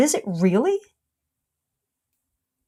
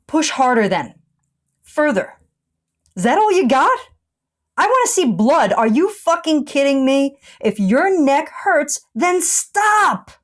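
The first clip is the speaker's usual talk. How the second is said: higher-pitched mock drill-instructor goading, building to a punched "stop"; loud sarcasm; 6 semitones higher than usual. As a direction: goad them sarcastically, building in pitch and force. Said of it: "the sarcasm was evident".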